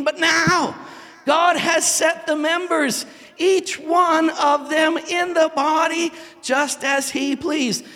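high, slow, deliberate verse; slow solemn scripture reading. Acted. Pastor reading Corinthians to the congregation, slow and solemn. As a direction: let each word of scripture land.

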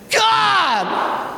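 high, drawn-out mock wail; hammed-up shout to heaven; exasperated cry to God. Complainer missing the comfortable old place, crying out to God.

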